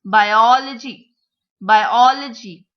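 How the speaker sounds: BIOLOGY as bi-OL-ogy, OL loud and stretched; second-syllable stress. Shown as a demonstration. Teacher modelling four syllabic words, second syllable stressed. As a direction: hit and hold the OL of "biology". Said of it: "second syllable".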